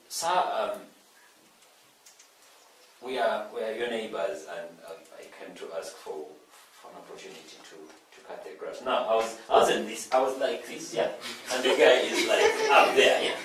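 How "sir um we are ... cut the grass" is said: put-on much softer, small boy's pleading voice; hushed, faster timid request to the big neighbor; timid boy asking. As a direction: shrink and ask softly like a nervous ten-year-old.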